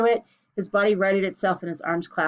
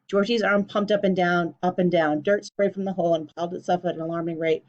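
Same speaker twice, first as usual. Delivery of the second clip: ordinary delivery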